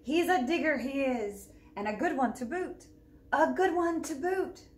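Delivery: loud hearty pirate call to the crew; pirate yell. Acted. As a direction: shout it back across the beach. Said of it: "yelled back to his crew".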